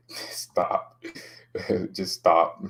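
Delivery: usual speaking voice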